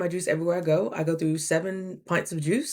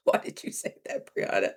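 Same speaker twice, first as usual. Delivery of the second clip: self-scolding whisper; 5 dB quieter than usual. Retold or imitated. Scolding herself after sassy remark.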